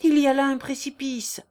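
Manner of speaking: acted alarmed outburst; alarmed warning. A narrator's reading. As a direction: warn urgently of danger. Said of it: "s'écria Léon".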